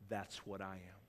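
a soft, understated confession; hushed confession. Acted. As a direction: say it like a quiet admission.

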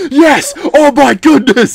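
loud, very high mock amazement; hammed-up ringside yell; eighties wrestling commentator. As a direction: shout it drawn-out and thrilled. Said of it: "eighties W W E".

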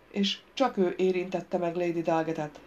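ordinary delivery, nothing marked

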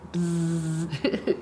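buzzing buzzer noise; wrong answer buzzer. Pretend. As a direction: buzz like a game show buzzer. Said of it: "make a buzzer sound like this".

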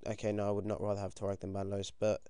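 ordinary talk

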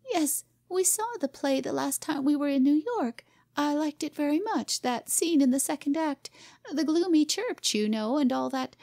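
high, nervous hostess chatter; flustered society wife. A narrator's reading. Hostess chattering to guests, high and flustered.

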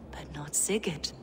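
breathy secret whisper. Acted. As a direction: hush it like a secret. Villain hushes to a whisper.

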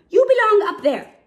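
plain reading voice

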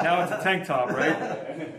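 laughing. Real, not acted. Showing off old tank top.

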